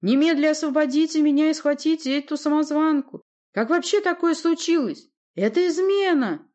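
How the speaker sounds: very high, loud tirade; outraged shouting. A narrator's reading. Prince orders guards to seize the impostor, outraged shouting. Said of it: "вскричал князь".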